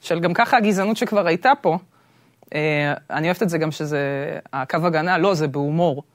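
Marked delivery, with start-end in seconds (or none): none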